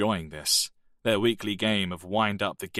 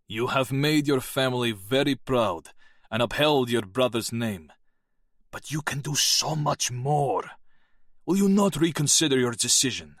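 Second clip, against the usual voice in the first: put-on a raised, gruff old Soviet colonel; colonel's voice; 4 semitones higher than usual. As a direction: urge the young officer as a proud colonel.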